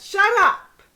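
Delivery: hammed-up shut up; mock shout. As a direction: snap it like a fed-up parent.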